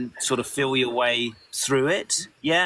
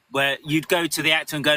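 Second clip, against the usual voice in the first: ordinary delivery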